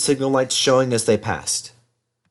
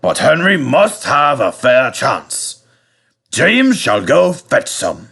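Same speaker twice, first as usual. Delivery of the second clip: fat controller; 3 semitones higher, 4 dB louder, slower than usual. Acted. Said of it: "said the fat controller thoughtfully".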